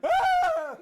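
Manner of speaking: very high, loud shriek mocking the kid; imitated scream. Mom imitating her son shrieking at the gross scene, loud high shriek. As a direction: let out a piercing mock scream. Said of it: "and you were like".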